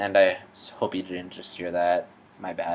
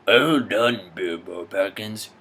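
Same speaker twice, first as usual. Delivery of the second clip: wizard Gandalf. Acted. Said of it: "The Hobbit".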